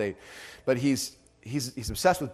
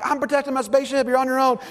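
mock panic; 10 semitones higher, 4 dB louder, faster than usual. Dad refusing to help kids.

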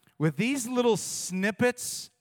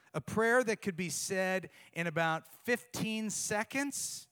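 usual speaking voice

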